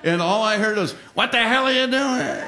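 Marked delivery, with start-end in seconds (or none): 1.1-2.4 high, put-on voice calling across the sky; leprechaun instructor's yell. Instructor flying past the flailing cadet, mock outraged yell. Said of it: "the leprechaun comes flying by".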